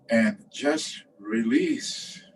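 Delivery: drawn-out, slow guiding words; slow release. Real, not acted. Teacher guiding students to breathe out and let go, slowly. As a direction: stretch it out with pauses to let them go.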